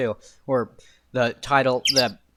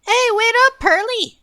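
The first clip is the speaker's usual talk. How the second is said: calling out; 5 dB louder than usual. Acted. Little girl calling after someone.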